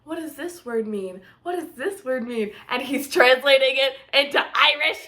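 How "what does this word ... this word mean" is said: soft curious quote. Retold or imitated. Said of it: "she's like".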